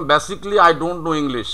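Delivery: Basically said "be-sik-li"; silent A. Shown as a demonstration. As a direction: drop the A in Basically, ending "-sikli". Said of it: "যেমন এই আমি যদি এটা উচ্চারণ".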